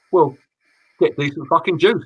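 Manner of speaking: ordinary delivery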